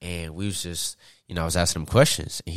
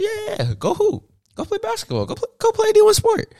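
high, mocking sing-song of the family; mock them in a high cheery voice; high teasing impression of his family; 13 semitones higher, 5 dB louder, faster than usual. Family voiced in mocking retold quote.

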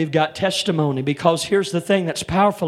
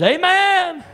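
high, loud, drawn-out "Amen"; called out amen; 14 semitones higher, 6 dB louder than usual. Pretend. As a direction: ring out "Amen" like the congregation answering. Preacher voices congregation's amen response.

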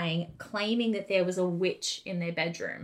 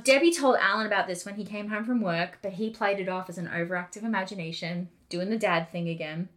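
ordinary talk, nothing marked

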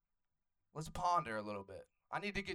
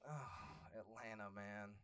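wistful sigh; 5 semitones lower, 14 dB quieter, much slower than usual. Retold or imitated. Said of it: "I'm just like".

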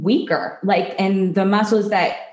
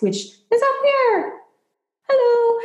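much higher, light head voice. Shown as a demonstration. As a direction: lift it up into head voice, slow. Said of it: "and then you have your head voice".

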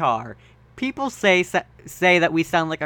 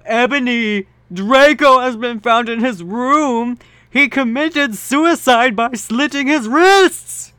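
higher, louder, slow solemn announcement; grave Dumbledore; 7 semitones higher, 7 dB louder than usual. Acted. Dumbledore telling Ebony of Draco, slow and dramatic.

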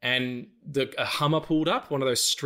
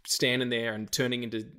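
usual speaking voice

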